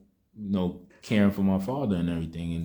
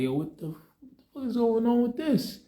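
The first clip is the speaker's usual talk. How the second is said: much higher, shocked retold outburst, just short of a real yell; shocked outrage. Siblings retelling their outburst at hospital staff, stunned outrage. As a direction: blurt it out like you can't believe what you're seeing.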